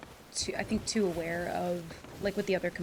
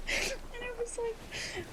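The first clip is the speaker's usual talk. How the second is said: crying with laughter; 14 semitones higher, 4 dB quieter, much slower than usual. Real, not acted.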